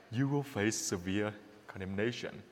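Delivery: low, hushed verdict; quoted warning in a grave undertone; solemn pronouncement. Retold or imitated. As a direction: pronounce judgement very softly and low. Quotes the Lord's stern judgement.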